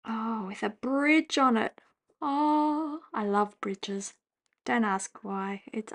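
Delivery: ordinary talk, nothing marked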